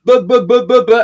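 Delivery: loud, high repeated stammer; mocking stutter. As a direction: take the piss out of a stutterer. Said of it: "and took the piss out of him".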